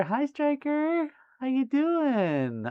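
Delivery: very high, drawn-out hello; gushing greeting. Real, not acted. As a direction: sing out a sweet, slow greeting. Greeting a viewer warmly by name.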